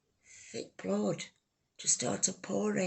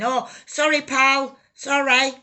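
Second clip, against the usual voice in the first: apologetic neighbour; 7 semitones higher, 9 dB louder than usual. Retold or imitated. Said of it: "heard somebody saying".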